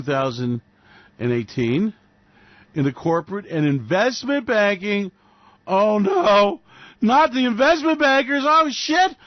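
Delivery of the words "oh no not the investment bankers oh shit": high, loud fake alarm; mock dismay. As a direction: play it up as fake horror at the news. Mock horror at banker layoffs.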